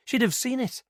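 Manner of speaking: put-on earnest young witness telling it eagerly; girl's voice.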